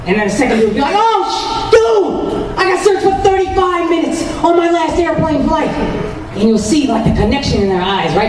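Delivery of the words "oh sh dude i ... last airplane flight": high-pitched, amazed buddy topping the story; excited friend voice. Acted. Second friend bonding over airport searches, excited. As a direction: burst in with a bonding "dude" moment.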